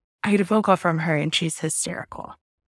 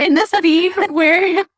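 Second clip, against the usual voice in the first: higher, louder, slow, breaking into laughter; laughing; 11 semitones higher, 6 dB louder, slower than usual. Real, not acted. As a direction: laugh through the words as the scene comes back. Laughing while recalling the movie.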